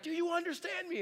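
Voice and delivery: put-on high, loud parent yell at fighting boys; yelling at the kids. Parent yelling at two fighting boys to stop yelling. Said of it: "the way in which you were doing it is by yelling".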